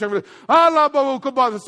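slow babbled tongues syllables; demo of tongues. Shown as a demonstration. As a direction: show them how tongues start. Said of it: "I'm not gonna speak English".

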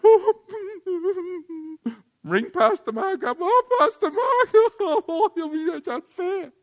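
hammed-up high-pitched wailing; exaggerated self-pitying howl; mock victim. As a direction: wail for help like a sorry victim. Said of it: "It's easier to play the victim".

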